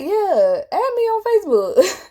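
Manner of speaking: high-pitched, breezy yes in retelling; imitating your own quick, high-pitched agreement; eager agreement. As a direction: act out saying yes, pitch leaping up. Eagerly agreeing to friend request.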